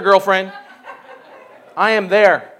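normal talking voice

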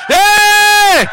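long high "hey" shout; revival holler. Real, not acted. Preacher stirring up the church, drawn-out high holler. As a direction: holler it out to the crowd. Whooping up the congregation.

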